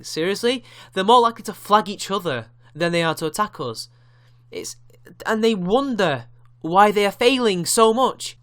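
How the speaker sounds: angry rant. Real, not acted. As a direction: vent the frustration. Angry rant about infighting.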